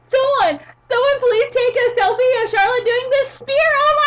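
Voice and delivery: very high, loud mock squealing; hammed-up sorority girl screaming for a photo; shrieking sorority girl. Sorority girl begging for a selfie of Charlotte, mock shriek. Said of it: "That is the word sorority".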